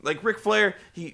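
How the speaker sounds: usual speaking voice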